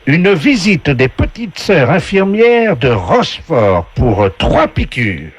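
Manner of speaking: high, slow host announcing prizes; game show voice. Acted. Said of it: "la voix du jeu".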